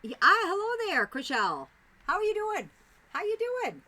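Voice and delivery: high, loud sing-song hello; excited greeting. Real, not acted. Streamer welcoming Chriselle into chat, loud and high. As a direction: call out a big welcome.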